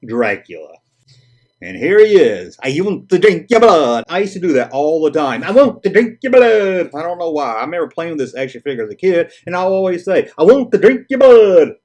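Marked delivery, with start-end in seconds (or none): 2.6-4.0 louder, higher hammed-up vampire accent; Southern speaker attempting a vampire voice; hammy vampire catchphrase; Dracula. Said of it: "trying to do a Dracula accent". 5.4-6.9 high, loud hammed-up vampire accent; badly faked vampire with a Southern twang; Dracula. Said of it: "trying to do a Dracula accent". 10.4-11.8 high, loud hammed-up vampire accent; badly faked vampire with a Southern twang; Dracula. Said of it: "trying to do a Dracula accent".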